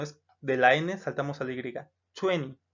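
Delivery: usual speaking voice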